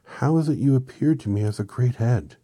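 high, loud, puzzled question; bewildered. A narrator's reading. Dorothy questioning Oz about the head, bewildered voice. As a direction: ask it loudly, at a loss. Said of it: "in a bewilderment".

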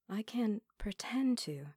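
usual speaking voice

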